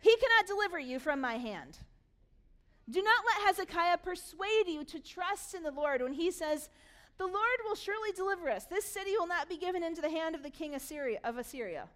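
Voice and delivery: loud, high commander's proclamation; calling out. Acted. Assyrian commander warning the people against Hezekiah, loudly calling out. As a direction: project it forcefully over a crowd. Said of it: "the commander stood and called out".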